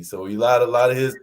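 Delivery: ordinary talk, nothing marked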